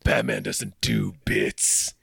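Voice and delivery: drawn-out grim vigilante parody; slow joking Batman voice; mock Batman. Joins the Batman bit.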